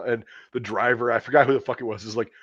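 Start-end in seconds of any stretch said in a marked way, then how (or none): none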